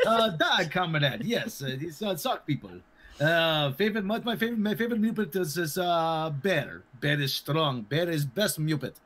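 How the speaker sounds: Russian comrade voice answering about the sock people; mock Russian accent. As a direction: ham up the broken Russian English. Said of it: "I pulled a Russian accent out".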